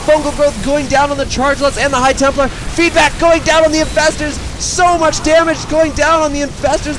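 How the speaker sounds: high, loud, fast play-by-play hype; really excited. Real, not acted. Tired caster rallying the viewers for the final battle, roaring excitedly. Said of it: "put one last burst of energy into getting really excited".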